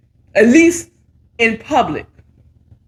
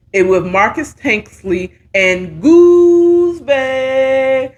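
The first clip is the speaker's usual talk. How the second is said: announcer voice; much slower than usual. Acted. Announcing show title.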